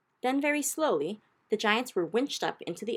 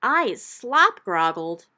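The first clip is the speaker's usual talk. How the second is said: very slow, much louder giant squawk; 3 semitones higher, 10 dB louder, much slower than usual. Acted. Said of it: "squawked the gizzard gulper".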